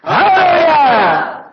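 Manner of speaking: huge high shout, stretched slow; shouted hallelujah. Acted. Preacher leading the congregation in a practice hallelujah, full shout. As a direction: shout it to knock the wall down. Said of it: "let's shout the hallelujahs".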